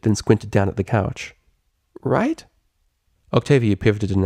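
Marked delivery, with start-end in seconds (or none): 2.0-2.4 high, unsure little question; fidgety, seeking agreement; nervous. A narrator's reading. Rainbow Dash fidgeting, asking Octavia, unsure. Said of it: "She fidgeted".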